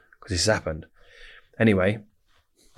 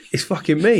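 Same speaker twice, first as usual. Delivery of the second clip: laughing reveal; 9 semitones higher, 4 dB louder than usual. Retold or imitated. Revealing he is the target.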